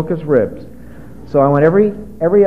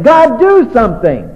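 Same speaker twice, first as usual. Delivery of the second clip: slow, loud desperate plea; crying out; 6 semitones higher, 5 dB louder than usual. Pretend. Wife with a stubborn husband begging God, loud slow cry. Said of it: "she cries out to God".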